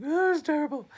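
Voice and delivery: hammed-up lament, high and very loud; mock mourning wail. Imitates villagers mourning a dead child.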